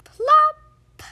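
high popping plop; plop sound. Acted. Narrator makes a landing plop. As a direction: pop it out like a drop landing. Creature lands.

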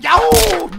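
sudden high yelp. Real, not acted. Shout while cutting the package.

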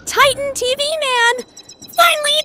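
high, excited fan cry; thrilled squeal. Real, not acted. Fan greeting Titan T V Man at last, thrilled squeal. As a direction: squeal upward like your favourite finally showed up. Thrilled his favourite finally appears.

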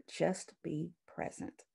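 hushed. Shown as a demonstration. Teacher modelling calm presence for students, slow soft. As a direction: say it slowly and softly. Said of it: "Silence is powerful".

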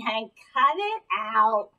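ordinary delivery

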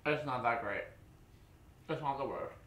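talking between bites; chewing while talking. Real, not acted. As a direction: mouth partly full of cereal. Seen 1: Man spoons bowl just before, lips pursed, jaw chewing while talking.

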